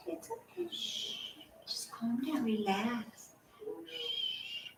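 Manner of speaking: calm him down gently; soothing hush. Real, not acted. Owner hushing her restless dog, soft soothing voice.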